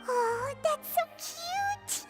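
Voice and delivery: slow, high-pitched cooing; gushing. Acted. Friend hearing a sweet love story, gushing. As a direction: draw it out adoringly.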